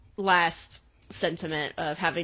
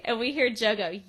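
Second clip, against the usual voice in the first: ordinary delivery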